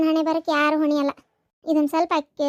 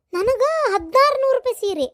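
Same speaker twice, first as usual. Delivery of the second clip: pitched-up resentful outburst; bitter indignation; 6 semitones higher than usual. Acted. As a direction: spit out the insult of the cheap sari. Bitter comparison of gifts.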